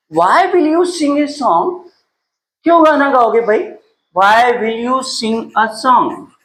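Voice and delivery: normal talking voice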